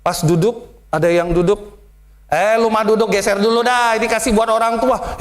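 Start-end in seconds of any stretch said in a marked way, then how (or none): 2.3-5.2 slightly raised, blunt Betawi order; rough Betawi talk. Retold or imitated. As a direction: shoo them off like a Betawi elder. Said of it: "kok gitu ngomongnya". (perintah Betawi yang kasar)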